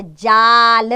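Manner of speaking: जाल read slowly and loudly, long आ; slow clear reading. Shown as a demonstration. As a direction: stretch the आ in जाल for children. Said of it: "कुछ शब्दों को हम पढ़ते हैं".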